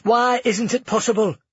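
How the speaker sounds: fast, high, urgent demand; alarmed. A narrator's reading. Lucia demanding why her daughter can't be brought, fast and alarmed. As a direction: press them for answers, rushing and high.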